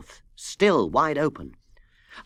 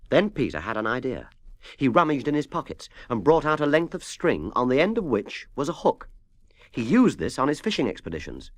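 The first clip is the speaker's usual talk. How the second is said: usual speaking voice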